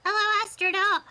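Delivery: put-on high, bright child calling out; kid character voice. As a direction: call out eagerly like a little kid. Reads a kid character's lines.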